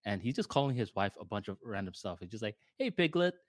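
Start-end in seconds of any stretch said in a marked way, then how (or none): 2.8-3.3 high-pitched teasing pet names; joking. Retold or imitated. Husband calling his wife names in front of friends, joking. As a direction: imitate a husband playfully ribbing his wife. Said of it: "just as a joke".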